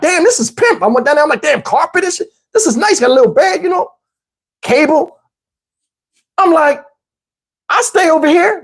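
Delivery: high, excited admiration; impressed. Retold or imitated. He sees girlfriend's basement, excited and high. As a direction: gush over the room.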